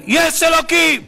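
high, loud outcry; sudden shout. Retold or imitated. Survivor waking up in his secular home, shouting out. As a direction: cry it out all of a sudden. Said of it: "מה הוא צועק".

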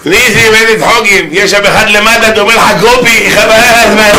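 high, strained gushing voice; hammed-up squeaky excitement; excited onlooker. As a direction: play a thrilled witness in a high pitch.

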